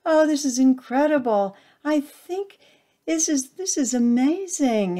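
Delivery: high, loud joyful gush; overjoyed. A narrator's reading. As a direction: overflow with joy. Heart overflows with joy.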